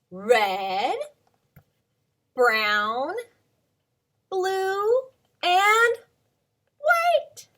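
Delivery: loud, each color called with a pause, rising high at the end; calling out colors. Shown as a demonstration. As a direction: lead the kids in naming colors. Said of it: "will you say 'em with me?".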